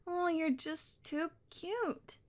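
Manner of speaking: put-on slow, stretched-out high baby talk; doting coo. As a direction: gush over how cute it is. Doting on the kitty.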